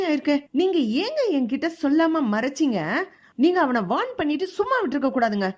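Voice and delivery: furious wife demanding answers, voice pitched high; angry through gritted teeth. Acted. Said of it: "கோவத்தோட பல்ல கடிச்சுக்கிட்டு பேசும்போது".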